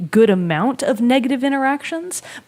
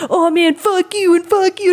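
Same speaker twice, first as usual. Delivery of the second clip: edgy kid imitation; 9 semitones higher than usual. Kid cursing at players to seem edgy, high mocking imitation. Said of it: "trying to be super cool and edgy".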